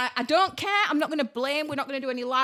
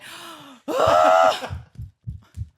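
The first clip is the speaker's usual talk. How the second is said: loud exasperated sigh; 3 semitones higher, 6 dB louder than usual. Real, not acted. Exhales after the gross story.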